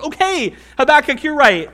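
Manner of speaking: high, loud blurted admission; God imagined confessing to Habakkuk, bursting out; mock confession. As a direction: admit it all in a rush. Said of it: "bursting out".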